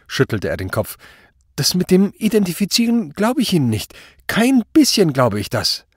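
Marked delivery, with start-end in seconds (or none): 1.6-5.9 high, loud, frightened disbelief; agitated protest. A narrator's reading. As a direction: protest desperately, voice pushed right up. Frightened suspect protests his disbelief. (aufgeregter, hoher Protest)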